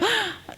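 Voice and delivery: acted intake of breath, suddenly frightened; startled gasp. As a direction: suck in a sudden breath of alarm. Acting Peter's alarm at the wind.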